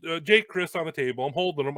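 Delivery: ordinary talk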